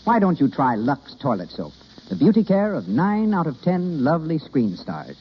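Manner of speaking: usual speaking voice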